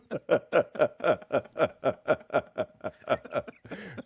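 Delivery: long, rolling fit of laughter; helpless laughter. Real, not acted. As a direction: crack up and keep laughing. Cracks up at misread word.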